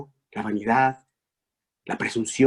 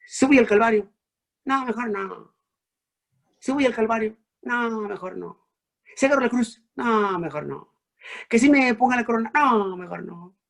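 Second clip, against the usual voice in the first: hesitant Jesus; 10 semitones higher than usual. Acted. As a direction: weigh the cross, then pull back. Jesús dudando, voz actuada. (Jesús vacilante, dudando ante el Calvario)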